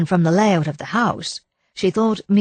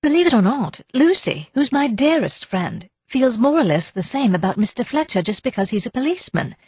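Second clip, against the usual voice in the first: higher, lively character line; lightly voiced Daisy; 7 semitones higher than usual. A narrator's reading. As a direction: speak up as a frank Daisy, lightly acted.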